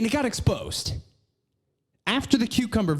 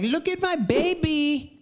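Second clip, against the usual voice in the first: high, gushing imitation of her posts; put-on cutesy squeal; mock doting mother; 10 semitones higher than usual. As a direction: show off the baby in a mocking high voice. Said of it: "the photos she posts of".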